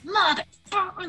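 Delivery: high, exasperated mock curse at the apples; mock cussing. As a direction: spit the curse out high and fed up. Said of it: "I'm cussing".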